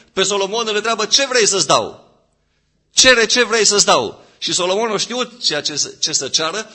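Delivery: loud, high-pitched fervent preaching; preaching at full force. Real, not acted. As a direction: raise the voice and proclaim it to the congregation. (predică înflăcărată, cu voce ridicată)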